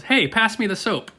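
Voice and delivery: lightly voiced cartoonish turtle line; joke turtle. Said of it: "One turtle looks at the other".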